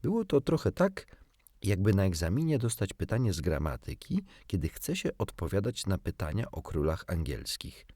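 ordinary delivery

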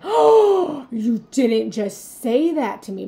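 put-on a wounded inner child gasps in disbelief; shocked gasp.

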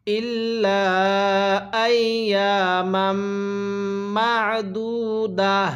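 drawn-out chanted recitation; slow melodic Quranic chant. Shown as a demonstration. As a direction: recite it like a tajweed teacher. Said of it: "এরকমভাবে পড়ব".

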